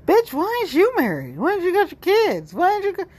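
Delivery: hammed-up scolding from the family down South; mock nagging relative. Relatives in the South grill her about marriage, mock high nagging.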